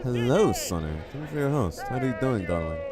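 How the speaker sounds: effusive greeting. Real, not acted. Effusive greeting to a viewer who hosted.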